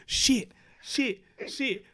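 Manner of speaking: short high repeated word, spaced out; imitating repeating baby. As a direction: mimic a toddler proudly parroting a cuss. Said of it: "she kept saying it".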